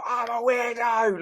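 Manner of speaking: high, slow shouted yell; retold scream. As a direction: belt it out the car window. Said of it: "and she screamed out".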